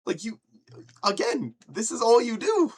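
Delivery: high, loud protest; exasperated mock yell. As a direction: cry it out in comic disbelief. Repeats exasperated rant at band.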